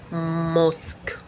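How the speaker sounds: "موسك" stretched very slowly; sounded out. Shown as a demonstration. Teacher sounding out "موسك" for learners, drawn out very slowly. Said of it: "ننطق كده نستهجى".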